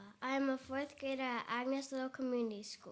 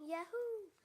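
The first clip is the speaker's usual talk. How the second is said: higher cheerful yahoo; bright poem yahoo; 7 semitones higher than usual. A narrator's reading. Girl reading her poem to the crowd, cheering yahoo. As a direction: cheer it out on the word. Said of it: "I'm yelling".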